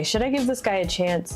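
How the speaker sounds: usual speaking voice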